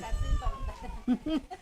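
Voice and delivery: laugh. Real, not acted. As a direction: chuckle softly to yourself. Risa tras la broma. (risita en voz baja)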